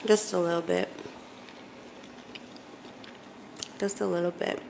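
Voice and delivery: talking between crunchy bites; snacking while speaking; crunching chips. Real, not acted. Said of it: "you may hear me crunching".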